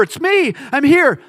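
high, loud call across a river. Acted. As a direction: shout to be seen from the far bank. Jesus calling across a river.

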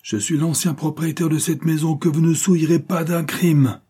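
high, booming proclamation; commanding, resounding outcry; ringing declamation. A narrator's reading. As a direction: thunder it out to stop a fight. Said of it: "lança d'une voix éclatante".

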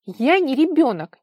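high, loud indignant protest; heated outburst. A narrator's reading. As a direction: flare up and protest loudly. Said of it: "вспылила Вара". (вспылив, громко и высоко)

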